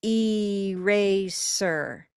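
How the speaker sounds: ERASER drawn far out, starting on a long EE; exaggeratedly slow ee-RAY-ser; too slowly. Shown as a demonstration. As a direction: stretch every syllable of eraser. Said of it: "if you say it too slowly".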